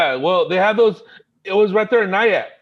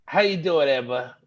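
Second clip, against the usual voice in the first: James Gandolfini; 2 semitones lower, 4 dB quieter than usual. Acted. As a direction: greet her in a celebrity mobster impression. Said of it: "He does the best James Gandolfini".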